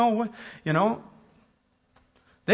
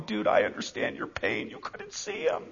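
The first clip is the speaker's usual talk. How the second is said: hushed sympathy; 10 dB quieter than usual. Retold or imitated. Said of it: "Then they'd be going".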